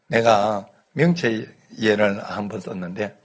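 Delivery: normal talking voice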